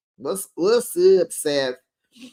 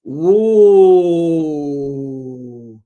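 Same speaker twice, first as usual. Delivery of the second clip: loud, drawn-out falling ooh; long ooh; 9 dB louder than usual. Real, not acted. Reacting to Whitney challenging Meredith, a long loud ooh. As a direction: let out a big scandalized "ooh".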